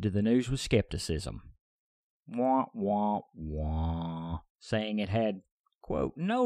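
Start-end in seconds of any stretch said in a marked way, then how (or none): 2.2-4.4 wah-wah sad trombone imitation; slow descending mocking wahs. Podcaster mocks Russian skepticism with sad-trombone wahs.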